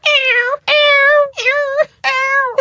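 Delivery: high whiny cat yowls; cat yowling. Retold or imitated. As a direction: yowl like a demanding sick cat. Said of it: "he's like".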